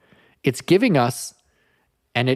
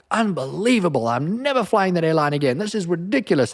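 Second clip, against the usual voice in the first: mock outrage, pitched much higher; fed up traveller; 6 semitones higher than usual. [name] mocking himself over a cancelled flight, exasperated outburst. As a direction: play his own airport tantrum for laughs. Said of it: "I'm the first one to be like".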